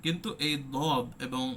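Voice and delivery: usual speaking voice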